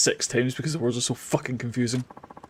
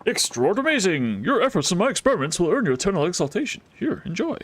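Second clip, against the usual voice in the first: voiced NPC accent; 4 semitones higher than usual. Streamer giving the inventor a new accent again. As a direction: announce the praise like a pompous inventor. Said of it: "a different accent every time".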